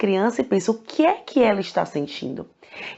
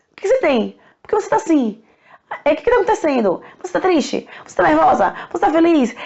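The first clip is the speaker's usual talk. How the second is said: higher, pushy run of nagging questions; irritating barrage of questions; 7 semitones higher than usual. Retold or imitated. Someone upset is nagged with questions, higher and pushy. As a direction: pester someone who is upset, question after question. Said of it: "alguém ficar perguntar".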